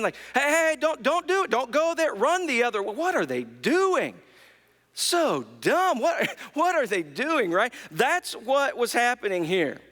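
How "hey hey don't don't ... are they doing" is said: mock yells at a movie character, pitched up; hammed-up frustrated viewer shouting warnings; yelling at the screen. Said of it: "yelling at the characters on the screen".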